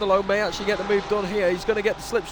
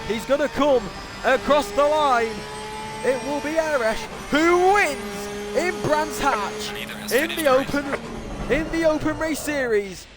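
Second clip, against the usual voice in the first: high, stretched-out victory commentary; excited winner call; 7 semitones higher than usual. Real, not acted. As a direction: build to a big finish-line cry. Calls the winner crossing the line.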